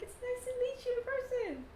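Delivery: very high, gushing greeting; excited wife. Acted. Wife meeting her husband's general, high excited greeting. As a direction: greet in a high, put-on wife's voice.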